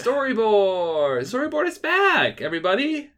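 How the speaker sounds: big, high, loud hype; excited announcement. Real, not acted. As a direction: announce it like a show host. Excited show intro shout.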